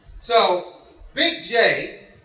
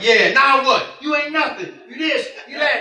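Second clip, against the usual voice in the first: higher, cocky mock taunting; trash talk; 4 semitones higher, 4 dB louder than usual. Bragging player taunts Big J after his misses, trash talk. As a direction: act out junk talk on the court. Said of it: "talking so much junk".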